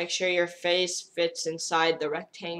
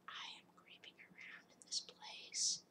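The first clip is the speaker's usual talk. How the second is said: very soft whisper; eyes-only filter whispering creepily; creepy whisper; 24 dB quieter than usual. Pretend. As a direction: creep through it in a secret whisper.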